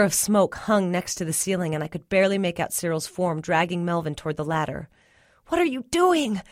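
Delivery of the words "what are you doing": high, urgent, strained question; choked. A narrator's reading. Questioning Cyril as smoke stings, choked alarm. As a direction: cry out in alarm through smoke. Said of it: "I choked".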